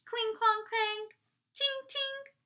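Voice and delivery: high, ringing monotone chime; sing-song sword clinks; clanging swords. A narrator's reading. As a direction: imitate metal striking metal, pitched well up. Narrator imitates clashing swords.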